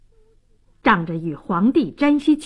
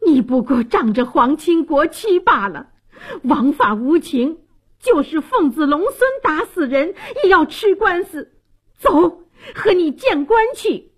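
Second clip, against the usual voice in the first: indignant scolding, rising higher; righteous outrage; angry rebuke; 6 semitones higher than usual. Acted. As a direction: scold a bully, building to a high-pitched order. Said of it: "怒斥道".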